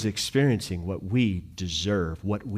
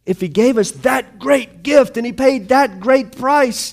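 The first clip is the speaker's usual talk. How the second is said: higher and louder, driving sermon climax; full-force preaching; 12 semitones higher, 9 dB louder than usual. Acted. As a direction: preach it hard to the congregation.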